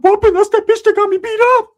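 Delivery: a silly high imitation of Ricky recognizing the guard; mocking Ricky. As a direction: yelp it way up high in outrage. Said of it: "He's like".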